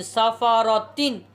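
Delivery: साफा रा तीन read slowly as joined syllables after spelling; showing the right way to read; blended reading. Shown as a demonstration. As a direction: join the letters into one word, slowly. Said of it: "चौथा लफ्ज़ जो है ता दो ज़ेर तीन".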